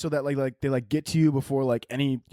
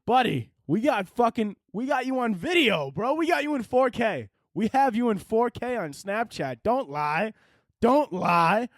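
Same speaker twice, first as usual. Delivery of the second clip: higher, slower gotcha taunt; mock taunting; 8 semitones higher, slower than usual. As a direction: rub the video in his face. Kid mocks drunk officer.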